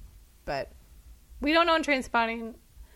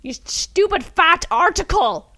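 hammed-up scornful shout, slow and louder; quoting the film's taunt with mock venom; mock insult; 7 semitones higher, 9 dB louder, slower than usual. Quoting the bullies in Circle of Friends, a hammed-up slow mock yell. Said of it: "That's what they call her".

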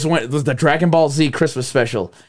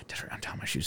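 hushed whispered request; whispering; 19 dB quieter, faster than usual. Retold or imitated. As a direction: whisper it to your sister. Whispered plea from bed.

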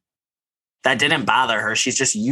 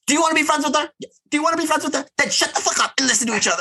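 very high, fast impersonated scolding; shrill comic telling-off, rattled out; squeaky mock scold; much faster than usual. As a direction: friend impersonates Jenny chewing out the group. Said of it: "Jenny was like".

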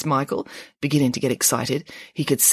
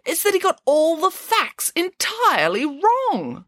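much higher, slow declaration; pig voice; 13 semitones higher than usual. A narrator's reading. Nanny Piggins declares to the children, high and slow. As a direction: pronounce it with grand certainty. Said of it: "said Nanny Piggins".

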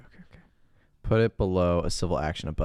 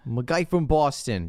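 higher, put-on Boston accent; 6 semitones higher than usual. Co-host echoing Travolta's movie accent, mock Boston. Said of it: "I can't even do his accent".

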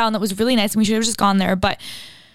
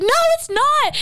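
mock screaming. Insisting to Liz outside the bar, high mock yell. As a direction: replay the shouting match in a squeaky high voice. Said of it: "screaming at each other".

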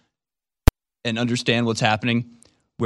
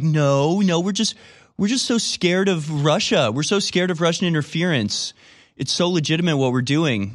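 much higher, slower whiny voice; mock scared innocence; 6 semitones higher than usual. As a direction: pretend to be frightened and sincere. Mocking Democrats' fear of Russia.

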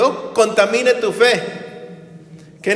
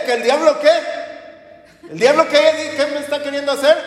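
mock defiance; 5 semitones higher than usual. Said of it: "Pero si dices".

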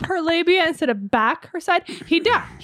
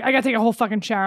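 fast, annoyed complaint; rushed disgusted rant; 3 dB quieter, much faster than usual. Retold or imitated. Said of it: "he's like".

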